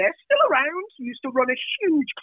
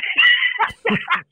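high shrieking burst of laughter; cracking up at the Birmingham dos story; 3 dB louder than usual. Real, not acted. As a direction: let a squeal of laughter out.

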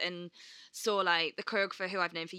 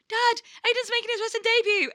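high, loud burst of excitement; excited announcement; 8 dB louder than usual. Retold or imitated. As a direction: blurt big news at top pitch, re-enacted. Retelling urgent big news to Dad.

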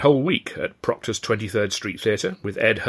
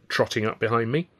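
ordinary delivery, nothing marked

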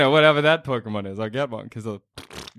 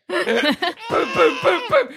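rising shouted booms; 11 semitones higher, much slower than usual. Acted. Smacking screeching Zubat on table.

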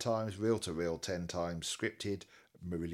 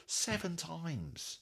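slow, high, disbelieving; incredulous; 9 semitones higher, 4 dB quieter, much slower than usual. Real, not acted. Host aghast a great song is rarely played, incredulous. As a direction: draw out the count in high-pitched disbelief. Aghast at low play count.

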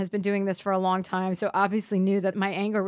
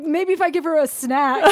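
mock therapist scheming; 8 semitones higher, 8 dB louder than usual. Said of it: "He's like".

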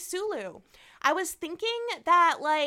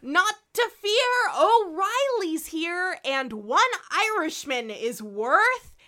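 put-on loud, slow drunken brag; Riley bragging, slow and loud; drunk Irishman; 4 semitones higher, 6 dB louder than usual. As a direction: boast like a drunk sailor.

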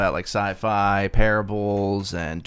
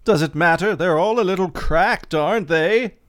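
higher, louder British husband; British accent; 8 semitones higher, 3 dB louder than usual. Acted. John scoffing at influencers to his wife, in a hammed-up British accent. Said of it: "I'll be John".

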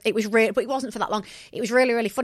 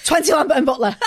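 louder, laughing order; laughing demand; 4 semitones higher, 6 dB louder than usual. Retold or imitated. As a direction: bark the order through a laugh. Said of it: "I was like".

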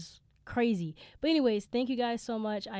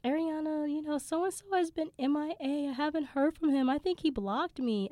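worried friend; 8 semitones higher than usual. Retold or imitated. Friend quoted asking for help.

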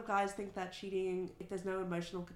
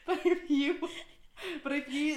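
laughing; 9 semitones higher, 5 dB louder than usual. Real, not acted. Laughing while asking a question.

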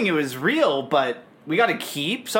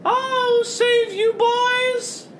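high, slow heroic rat cry; much slower than usual. Acted. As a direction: squeak it like a brave little rat. Mickey the Rat comes to rescue.

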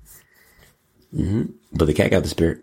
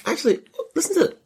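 squeaky; faster than usual. Acted. Squeaky excited interruption.

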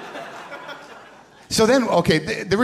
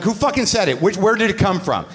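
higher, pushy mock outrage; retold angry demand; 7 semitones higher than usual. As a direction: interrogate the room, half in jest. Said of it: "I'm like".

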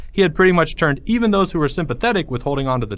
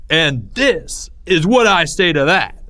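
slower, raised-pitch proclamation; defiant declaration; 4 semitones higher, 4 dB louder, slower than usual. A narrator's reading. Greg about to tear up the note, defiant. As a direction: declare it grandly to the onlookers.

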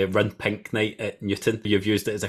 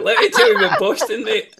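much higher, louder teasing voice; mocking impression; 12 semitones higher, 6 dB louder than usual. Host teasing runner guest about Boston. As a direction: lampoon her endless Boston talk. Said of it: "I knew that was coming".

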